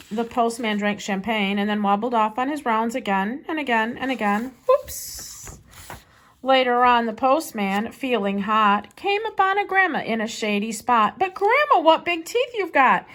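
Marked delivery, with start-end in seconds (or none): 4.7-5.6 high airy whoops; a sudden tipsy slip. Acted. Postman wobbles on bike. 11.2-13.1 higher, louder startled cry; a touch of mock fright at big teeth; mock alarm. Postman to wolf in disguise.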